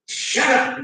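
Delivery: normal talking voice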